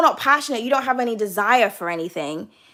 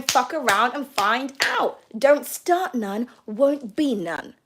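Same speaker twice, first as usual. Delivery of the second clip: slow warning; 3 dB quieter, slower than usual. Acted. Warning from the reading.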